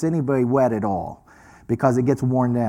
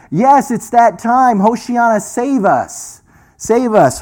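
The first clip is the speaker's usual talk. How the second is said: high, loud, drawn-out acclamation; crowd crying out; 8 semitones higher, 6 dB louder, slower than usual. Retold or imitated. Crowd waving palm fronds for Messiah, calling out high and loud. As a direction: cry it out like an expectant crowd. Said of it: "So they were saying".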